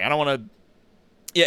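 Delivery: usual speaking voice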